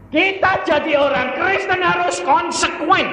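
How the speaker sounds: high pulpit shout; forceful exhortation. Real, not acted. Preacher urges Christians to be consistent, shouted exhortation. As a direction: drive it home at full voice.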